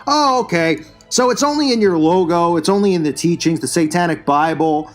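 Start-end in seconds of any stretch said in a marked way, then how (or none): none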